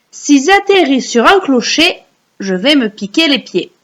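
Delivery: high, projected poem lines; recite for repeating. Shown as a demonstration. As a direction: call out each verse for children to echo. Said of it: "vous allez réciter après moi".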